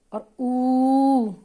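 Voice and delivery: ऊ held very long and loud, lips like a beak; long rounded oo. Shown as a demonstration. Teacher showing the long ऊ with a beak-shaped mouth. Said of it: "बाहर की तरफ मुंह खुलेगा".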